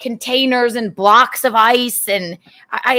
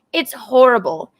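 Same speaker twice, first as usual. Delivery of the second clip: ordinary talk, nothing marked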